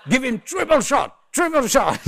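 loud, high hammed-up shout; mock urgent call. Queue calling for jet-lagged customer's coffee, mock urgent shout.

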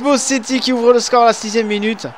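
high, loud goal call; excited sports commentary. Pretend. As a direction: shout it like a TV commentator. Goal scored in football game.

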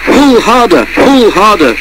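high, drawn-out urging to pull; rumbled urging. A narrator's reading. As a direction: strain to spur the others on. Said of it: "rumbled Thomas to the others".

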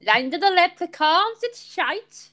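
high, lilting mock Irish; bad Irish accent. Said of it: "my Irish accent sucks".

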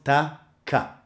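ordinary talk, nothing marked

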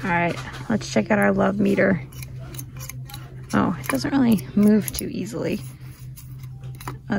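ordinary delivery

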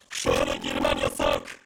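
very low, slow robotic guard voice; deep guard voice. Acted. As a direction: sound like a machine-voiced gatekeeper. Guard voice blocking the way.

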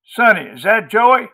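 high, loud raucous woman calling out. A narrator's reading. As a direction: holler raucously to someone in another room. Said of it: "heard Maudie's raucous voice".

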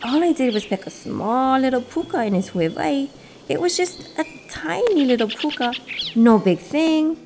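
high little bird making excuses; lightly acted, high-pitched self-defence; defensive minimizing. A narrator's reading. Bird plays down his misdeed.

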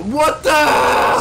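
loud, high yelp of disbelief; shocked shout. Real, not acted. Player startled by a giant fish, shocked shout. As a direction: shout as if ambushed.

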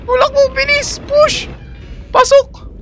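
high, loud panicked yells; panicked shouting. Real, not acted. Player panicking as the item falls, shouting orders.

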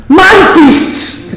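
high preacher's shout on "My feasts"; emphatic shout. Acted. Preacher voicing God's claim to the feasts, emphatic shout. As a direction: cry out "My feasts", pitched well up.

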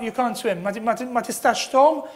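ordinary talk, nothing marked

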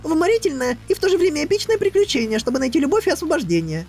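very high, fast bubbly mare; talking pony. Acted. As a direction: rattle on, giddy and breathless. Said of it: "она, похоже, не умолкнет".

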